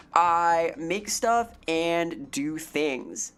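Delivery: very slow, stretched-out catchphrase; slowly stretched-out tagline; slow drawn out. Shown as a demonstration. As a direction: draw every word out slowly. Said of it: "slowed catchphrase".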